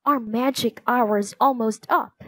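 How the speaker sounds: ordinary delivery, nothing marked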